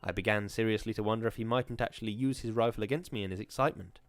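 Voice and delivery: usual speaking voice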